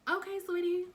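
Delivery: high, sugary, sarcastic okay sweetie; mock-sweet reassurance, pitched high. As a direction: drip fake sweetness on it. Mock sweet reply to friend.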